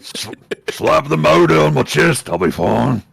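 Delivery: loud put-on orc. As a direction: play a dying orc, loudly. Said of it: "doing the orc voice for the last time".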